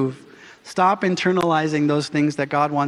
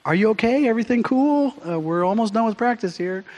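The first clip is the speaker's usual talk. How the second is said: late lady; 5 semitones higher than usual. Retold or imitated. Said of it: "is like".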